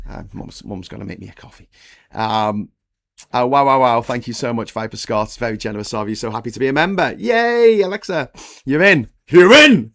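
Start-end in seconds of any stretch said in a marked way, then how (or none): 0.1-1.7 soft, quick aside; quiet aside about mum making coffee; hushed aside. Real, not acted. 7.2-9.9 high, loud cheer; loud, high celebration for a new member. Real, not acted.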